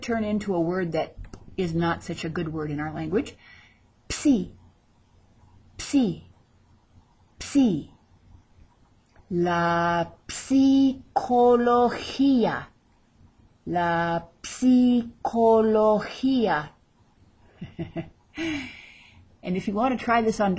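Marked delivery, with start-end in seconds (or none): 4.1-7.9 "Psi" with the P sounded right into the S; P-S-I together. Shown as a demonstration. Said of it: "pronounce the P, the S, and the I". 9.3-16.7 very slow "psicología" with a sounded P before the S; P-S-I together. Shown as a demonstration. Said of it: "pronounce the P, the S, and the I".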